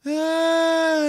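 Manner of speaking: very high, drawn-out cat yowl; imitating cat yowl. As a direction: wail like an old cat at four in the morning. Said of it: "and you're like".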